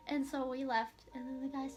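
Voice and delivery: ordinary talk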